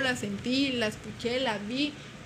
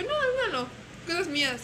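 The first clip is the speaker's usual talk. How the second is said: flustered denial; 6 semitones higher than usual. Retold or imitated. Brushing off friend's question nervously.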